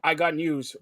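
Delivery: ordinary delivery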